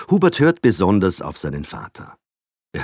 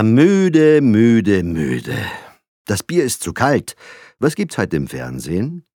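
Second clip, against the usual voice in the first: tired grumble; 6 semitones higher, 7 dB louder, slower than usual. A narrator's reading. Tired father to wife.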